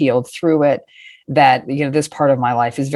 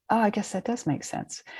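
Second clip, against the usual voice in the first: soft realization; 6 semitones higher, 12 dB quieter than usual. Retold or imitated. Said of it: "And I was like".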